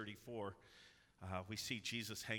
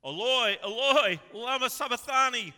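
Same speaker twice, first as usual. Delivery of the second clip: high, very loud cry from the cross; Jesus crying out in agony, very loud and high; loud anguished cry; 14 semitones higher, 18 dB louder than usual. Acted. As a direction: cry out to heaven at full voice. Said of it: "Jesus cried with a loud voice".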